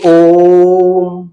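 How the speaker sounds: ओम with the ओ drawn out three times as long; pluta demo. Shown as a demonstration. Teacher demonstrates a pluta vowel to students, drawing out ओम very slowly. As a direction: stretch the ओ in ओम to triple length, very slow. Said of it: "उसके तीन बार के time को multiply करना है".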